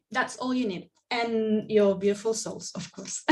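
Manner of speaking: ordinary talk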